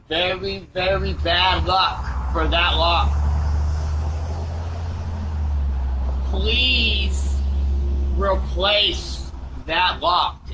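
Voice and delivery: slow halting doorbell camera audio; drawn-out, hesitant words over a doorbell mic; doorbell speaker. Real, not acted. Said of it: "his words get even stranger".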